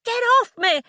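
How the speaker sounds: high, loud yell; yelling at the man gripping her leg; furious yell. Acted. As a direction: shout him off. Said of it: "I said".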